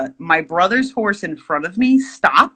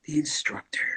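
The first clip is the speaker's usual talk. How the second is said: dramatic whisper; 4 semitones lower, 12 dB quieter than usual. Acted.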